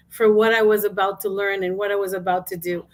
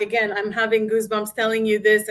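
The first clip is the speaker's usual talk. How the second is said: usual speaking voice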